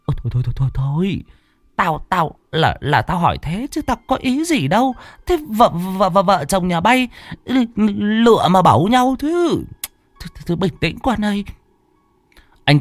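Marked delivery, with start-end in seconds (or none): 0.0-11.5 high, flustered, stammering calming; anxious pleading old man. A narrator's reading. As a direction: hurry to calm the son down. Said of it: "vội vàng chạy theo can ngăn". (giọng ông già cao, cuống quýt can ngăn)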